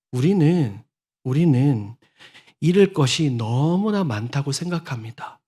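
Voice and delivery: ordinary talk, nothing marked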